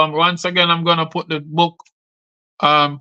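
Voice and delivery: usual speaking voice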